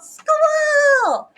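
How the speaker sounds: high, loud, drawn-out whoop; gleeful high-pitched shout; excited yell. A narrator's reading. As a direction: holler it like an eager child. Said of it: "she yelled".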